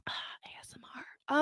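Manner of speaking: unvoiced, very soft whisper; hushed ASMR parody; mock ASMR whisper. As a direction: whisper it close to the mic.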